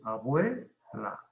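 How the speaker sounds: "abuela" slow, a-BUE-la with BUE held long; stress on BUE. Shown as a demonstration. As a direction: stretch BUE in "abuela", then let la fall. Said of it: "el acento es". ("abuela" pausado, con acento alargado en BUE)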